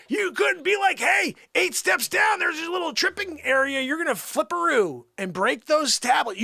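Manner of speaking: high, screaming comic rant; hammed-up shrieking outrage, very loud; furious tirade. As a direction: scream your grievance at God. Acted furious tirade at God.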